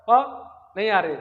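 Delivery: ordinary delivery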